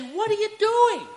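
high, startled parent voice; parent scolding. Retold or imitated. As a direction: play the parent who finally found the kid. Parents scolding the lost child.

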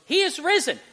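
high, bright-pitched Easter greeting down the phone; mock joyful greeting. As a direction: imitate the missionary's cheery phone call. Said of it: "he goes".

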